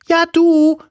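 very high, loud character voice; another, unknown voice. A narrator's reading. Unknown voice hails the tailor, very high and loud. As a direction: pipe up loudly in a squeaky-high voice. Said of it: "sagte die Stimme".

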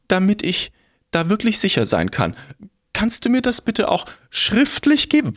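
fast, high, begging crone voice; pleading old woman. Acted. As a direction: rattle off pleas like a panicked old lady.

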